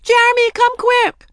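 very high, loud urgent summons; calling for help. A narrator's reading. Daisy summoning Jeremy, high frantic call. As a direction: shout in panic for someone downstairs. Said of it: "Daisy called out".

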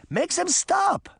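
very high, urgent command; high-pitched plea to halt an attack; old woman's cry. A narrator's reading. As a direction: cry out a desperate order, stretched and high. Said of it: "the old woman cried".

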